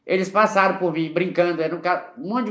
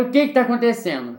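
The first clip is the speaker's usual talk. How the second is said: alarmed question; 6 semitones higher, 4 dB louder than usual. Retold or imitated. Mãe alarmed on phone.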